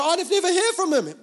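high-pitched whining protest; mock complaint. Preacher mimics believers who say God is silent, mock complaint.

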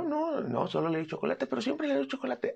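high-pitched defensive pet owner; whiny owner. Acted. Owner to vet, defending the chocolate, high and whiny. Owner pleading why cat died.

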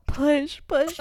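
high, loud mock birth coach; coaching push. Partner coaching her to push, hammed-up midwife. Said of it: "He was all".

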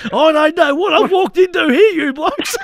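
put-on high, loud, baffled old man voice; a lightly voiced old bowler caught off guard. Old bowler quoted walking in.

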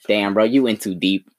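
ordinary delivery

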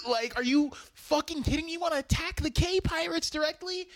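high, rushed disbelief; incredulous outburst. Acted. Teller confronting Gene about attacking the pirates, incredulous. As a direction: challenge the plan in fast, high-pitched exasperation. Incredulous challenge to Gene's plan.

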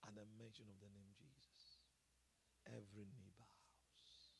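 slow breathy whisper of awe; reverent whisper. Acted. Preacher whispers to the congregation, slow and reverent. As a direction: hush it like a sacred secret.

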